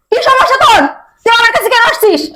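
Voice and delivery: high, indignant challenge; irritated rejection. Acted. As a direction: push him away with your voice. Wife rejects husband in her bed.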